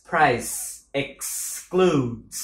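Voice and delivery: price excludes stretched very slow; syllable-by-syllable drill. Shown as a demonstration. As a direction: pull apart the ks sounds in excludes. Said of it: "âm cờ sờ".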